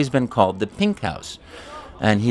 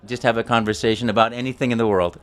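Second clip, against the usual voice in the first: normal talking voice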